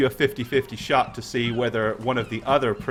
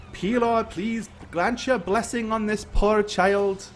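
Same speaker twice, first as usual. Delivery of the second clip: high, slow, solemn blessing; prayer; 8 semitones higher, slower than usual. Acted. Priest praying over the poor child, solemn. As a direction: intone a plea to a deity.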